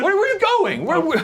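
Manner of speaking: high, laughing disbelief; surprised. Real, not acted. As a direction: call after them in shock. Teammate walking off laughing.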